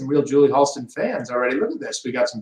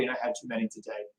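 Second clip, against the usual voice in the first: fast mumbled aside; 5 semitones lower, 17 dB quieter, faster than usual. Real, not acted. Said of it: "seven cups of coffee today".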